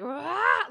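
a loud imitated shriek; mock scream. Imitating the film's unholy scream, loud mock shriek. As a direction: burst out with a mock demon scream. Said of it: "this weird buildup".

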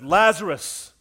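Jesus calls the dead man out, loud command. Acted. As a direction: call Lazarus loudly from the tomb. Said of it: "Jesus ... said".

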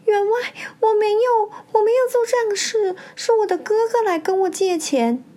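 high-pitched tearful pleading; tearful protest. A narrator's reading. Accused wife defends herself to her husband, tearful. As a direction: plead your innocence, close to crying. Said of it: "海棠欲哭无泪".

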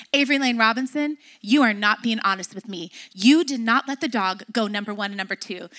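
low, measured telling-off; serious scolding. Retold or imitated. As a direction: lay down the accusation low and firm. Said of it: "Serious when you get the whole name".

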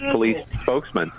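ordinary talk

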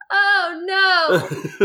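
high, hammed-up moan; mock moaning. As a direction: fake a dramatic moan, way up high. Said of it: "like, moaning".

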